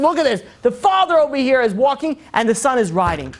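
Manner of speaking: high-pitched mock outrage; loud exclaiming gossip; indignant onlooker. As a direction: complain as if scandalized.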